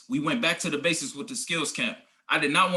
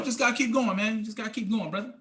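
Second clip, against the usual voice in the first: ordinary talk, nothing marked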